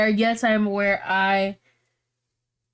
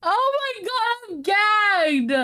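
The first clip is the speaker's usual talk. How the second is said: much higher, half crying with laughter; laugh-crying delight; 9 semitones higher, slower than usual. Real, not acted. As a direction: squeal with shocked delight. Reacts to comeback.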